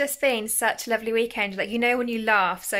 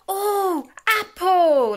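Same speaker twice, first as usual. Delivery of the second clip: imitating excited toddler; 6 semitones higher, 4 dB louder, much slower than usual. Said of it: "She goes".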